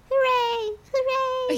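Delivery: tiny squeaky cheering mice; high cartoon mouse cheer; little mouse voices. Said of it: "the little mouse voices".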